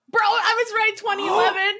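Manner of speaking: high, excited gloat; triumphant. Real, not acted. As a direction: celebrate being right. Triumph at correct guess.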